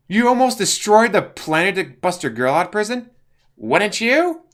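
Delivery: high, loud gloating villain voice; gloating Joker. Acted. Joker justifying the breakout, high loud gloat. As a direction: gloat over the plan. Joker explains his plan.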